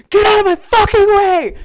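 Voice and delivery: high, loud crowd-clearing yell; retold shout. Bride running through the crowded casino, shouting.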